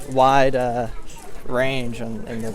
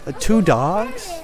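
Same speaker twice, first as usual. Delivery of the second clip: put-on higher, slow puzzled kid; 8 semitones higher, slower than usual. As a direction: ask like a confused child. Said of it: "a kid said".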